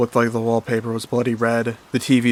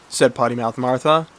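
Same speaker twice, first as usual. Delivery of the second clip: usual speaking voice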